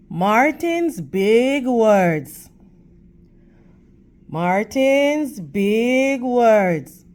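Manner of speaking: put-on title read slowly and clearly to children; slow, deliberate book title. Shown as a demonstration. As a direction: spell out the title slowly for the class. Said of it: "slow title read to class".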